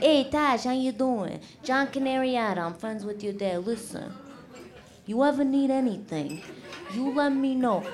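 soft-spoken Italian American mobster voice. Retold or imitated. Dad's mob friend greeting daughter, Italian American mobster voice. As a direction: play a wiseguy offering quiet favours. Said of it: "It's like".

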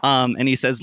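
ordinary talk, nothing marked

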